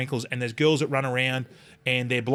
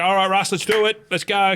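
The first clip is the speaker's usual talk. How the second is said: loud and higher, rallying Russell; calling out; 8 semitones higher, 6 dB louder than usual. Retold or imitated. Calling Russ over the blaring music, loud and higher. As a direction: call it out over the DJ.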